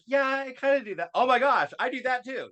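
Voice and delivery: very high-pitched re-enacted amazement; high mock-astonished self-recognition; excited realization. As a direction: squeal it as if it all just clicked. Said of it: "things are clicking and I'm like".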